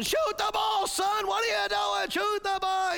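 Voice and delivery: high-pitched yell from the stands; yelling fathers. Acted. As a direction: holler like an impatient sports dad. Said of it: "the fathers are yelling".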